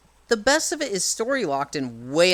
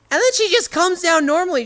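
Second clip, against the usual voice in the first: indignant; 13 semitones higher, 7 dB louder than usual. Real, not acted. Exasperated retelling.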